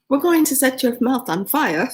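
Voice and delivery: a cheeky, menacing hello from the sauce; sly hot sauce greeting. Acted. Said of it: "the atoms of the hot sauce says".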